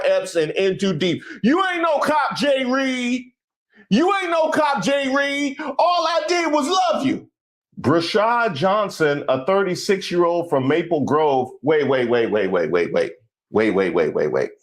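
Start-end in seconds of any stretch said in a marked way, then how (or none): 1.4-7.3 high-pitched, hammed-up anguished cry; melodramatic movie plea. Host mimicking an undercover-cop film, mock anguished. 11.6-14.5 low, rapid-fire string of waits; halting repeated waits. Pretend. Host stopping himself at a suspect's name, low and insistent. Halts the story on hearing name.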